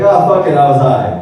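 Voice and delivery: loud laughing confession; exasperated loud admission; giving up, loud. Retold or imitated. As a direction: blurt it out, done lying. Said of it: "I was like".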